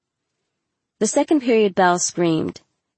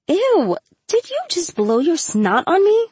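high-pitched disgusted disbelief; swooping, incredulous "ew"; grossed out; 11 semitones higher than usual. A narrator's reading. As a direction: squeal it in mock horror at a friend. Acted teasing disgust at friend.

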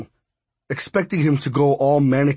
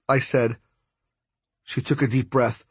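ordinary delivery, nothing marked

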